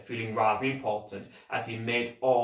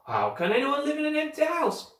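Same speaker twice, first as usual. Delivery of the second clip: much higher, louder mock-incredulous postman; scornful postman; 4 dB louder than usual. A narrator's reading. Postman mocking the children's question, scornful. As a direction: scoff at the kids' question.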